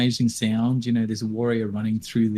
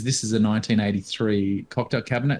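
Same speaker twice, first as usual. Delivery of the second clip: usual speaking voice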